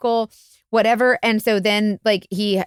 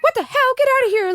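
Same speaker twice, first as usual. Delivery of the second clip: retold angry scream. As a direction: imitate the wife shrieking back at him. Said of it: "she starts screaming back at him".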